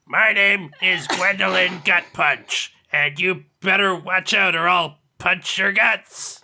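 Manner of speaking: slow, drawn-out threatening character voice; Gwendolyn Gut Punch. Acted. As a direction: make a hammed-up threat, word by word. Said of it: "I'll keep trying".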